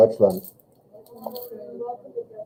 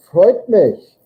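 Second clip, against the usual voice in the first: voicing roommate; 7 semitones higher, 4 dB louder than usual. Teacher voicing roommate reply.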